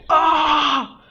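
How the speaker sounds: loud, high sustained yell; explosive cathartic "Ah!"; power-up scream. Retold or imitated. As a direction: let out an anime-style battle cry. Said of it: "I'm just like".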